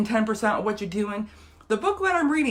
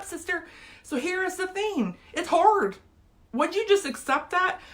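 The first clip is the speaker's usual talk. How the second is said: much higher and choked, near crying; tearful; 9 semitones higher than usual. Real, not acted. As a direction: fight through tears. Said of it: "I'm gonna get emotional on here".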